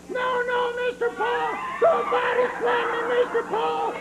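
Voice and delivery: very high squealing doll pleas; hammed-up shrieking puppet begging; Mister Bill doll screaming. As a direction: shriek like a doll being torn apart. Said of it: "What do you say now, Mister Bill".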